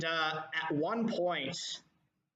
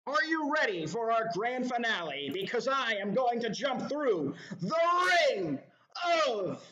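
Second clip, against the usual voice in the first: higher, louder ringmaster build-up; showman's announcement; 8 semitones higher, 4 dB louder than usual. Acted. Ringmaster announcing the grand finale to the crowd, hyped. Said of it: "speaks in a low baritone voice".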